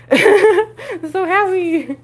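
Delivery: high, slow, delighted squeal; giddy happy. Real, not acted. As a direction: stretch it out, overjoyed. Delighted at the feast.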